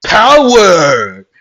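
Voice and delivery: big high yell of power; shouted battle cry. Retold or imitated. Mirio erupting upward, yelling his power. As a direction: bellow the move name from the ground. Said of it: "and just yell".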